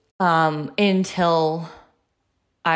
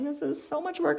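whiny complaint; 9 semitones higher, 7 dB quieter than usual. Retold or imitated. Quoted thought while carrying kids.

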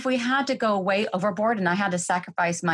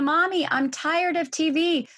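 put-on higher, whiny child's voice; kids' whine; 9 semitones higher than usual. Bored child complaining to mom, whining. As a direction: whine it like a bored little kid. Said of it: "They whine".

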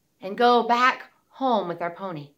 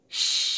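faucet shush. Shown as a demonstration. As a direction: let the air out like a tap. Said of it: "out through our mouth".

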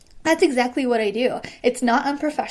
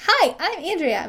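cheery intro; 6 semitones higher than usual. Retold or imitated. Bubbly intro being criticized.

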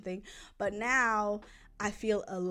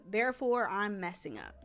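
ordinary talk

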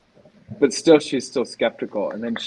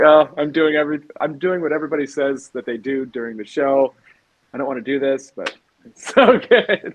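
higher-pitched imitation of tearful gushing; mock bawling; 4 semitones higher than usual. Retelling own tears at the show, mock bawling. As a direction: act out bawling with a laugh breaking in. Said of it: "I was, like, bawling".

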